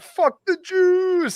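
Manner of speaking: high stretched heckler yell; drawn-out jeering shout; mock yell. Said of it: "somebody yelled".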